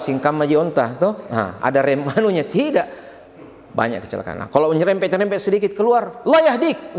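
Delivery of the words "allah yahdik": higher, sharp Allah yahdik at a driver. Retold or imitated. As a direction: snap it at someone who scraped your car. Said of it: "Weh, marah itu".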